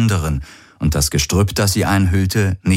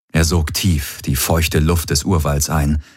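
plain reading voice

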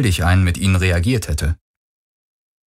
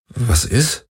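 mildly thrown, slightly raised question; short, puzzled query; irritated puzzlement; 4 semitones higher than usual. A narrator's reading. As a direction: ask it a touch irritated. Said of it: "fragte er irritiert".

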